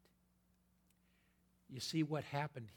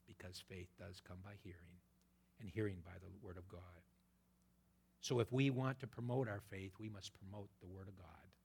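ordinary talk, nothing marked

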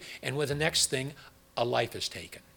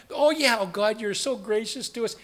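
mock gratitude; 6 semitones higher than usual. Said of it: "all these miracles by God".

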